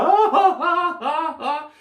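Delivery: very high, loud cackling; mock laugh up high; imitated laughing captain. Imitating the captain laughing at the fisherman. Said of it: "just dying laughing".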